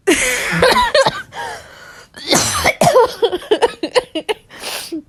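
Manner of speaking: high, loud shrieking laugh; screaming laughter. Real, not acted. Reader losing it at the fanfic, shrieking laugh. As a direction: let the laugh explode. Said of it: "I'm gonna cry".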